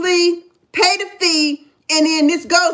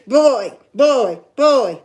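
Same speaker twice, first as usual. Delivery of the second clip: put-on low, loud contemptuous "Boy, boy, boy"; 5 semitones lower, 4 dB louder, much slower than usual. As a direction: spit out "Boy" as a cop would. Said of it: "I hate that term".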